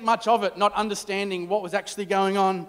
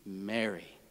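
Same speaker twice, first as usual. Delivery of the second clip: much lower, soft and quiet; tender name; 12 semitones lower, 15 dB quieter than usual. Acted. Jesus calling Mary by name, soft and tender. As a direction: speak her name gently, barely above a breath. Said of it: "Jesus simply spoke her name".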